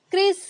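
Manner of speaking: क्रिस said with a short ई sound, not आई. Shown as a demonstration. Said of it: "आई की sound है क्रिसमस में वो ई pronounce हो रही है". (क्रिस में छोटी ई की आवाज़)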